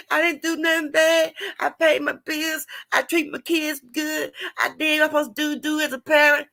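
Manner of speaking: high-pitched mock whine; put-on tearful, self-pitying complaint; crying and whining. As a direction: whine it out like a wronged victim. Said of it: "crying and whining".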